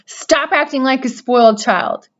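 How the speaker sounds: high, loud scream. A narrator's reading. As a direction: yell at him to move. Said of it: "screamed Wilbur".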